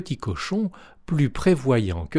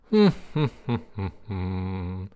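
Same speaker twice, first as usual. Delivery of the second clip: wolf's weaker laugh; 4 semitones lower than usual. A narrator's reading. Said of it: "Il rit encore, mais un peu moins".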